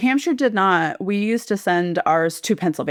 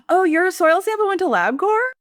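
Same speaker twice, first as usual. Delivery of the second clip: gasping surprise; 11 semitones higher than usual. Real, not acted. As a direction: caught off guard, voice leaps up in disbelief. Gasps at surprising news.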